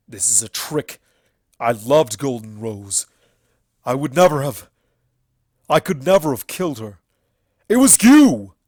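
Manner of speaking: rising from denial to a furious accusation; shouting. A narrator's reading. Everfree accusing the alicorns, building to a shout. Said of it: "he shouted".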